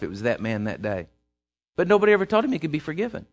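normal talking voice

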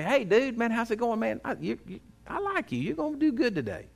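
bright, high, casual buddy greeting; friendly greeting. Retold or imitated. Preacher voicing a friend greeting someone, upbeat and high. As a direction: mimic a pal cheering you on.

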